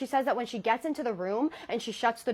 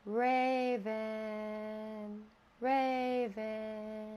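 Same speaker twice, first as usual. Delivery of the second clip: slow, hollow call, softer on the second; eerie calling; 5 dB quieter, much slower than usual. Retold or imitated. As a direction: call it like a faceless thing luring her. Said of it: "calling her name over and over".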